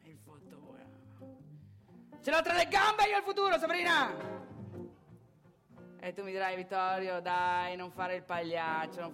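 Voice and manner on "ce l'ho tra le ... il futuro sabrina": high, fast, full-volume yell; furious high-pitched outburst; angry shout. Acted. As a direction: explode at your ex. Angry retort to ex about future.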